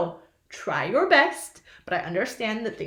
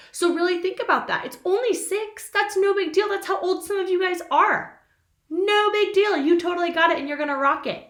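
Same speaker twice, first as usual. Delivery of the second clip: upbeat encouragement; 7 semitones higher, 3 dB louder than usual. Real, not acted. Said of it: "cheering kids on".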